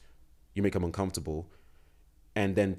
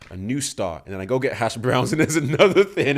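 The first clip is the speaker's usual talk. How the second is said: normal talking voice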